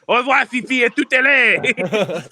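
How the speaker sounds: high, loud prancing French dandy; taunting farewell in hammed-up French accent; pretentious French accent. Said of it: "pretentious French accent".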